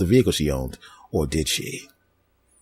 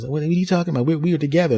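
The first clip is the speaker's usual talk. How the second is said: protesting ex; 9 semitones higher, faster than usual. Retold or imitated. Ex protests to new girl.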